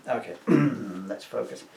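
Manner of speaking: usual speaking voice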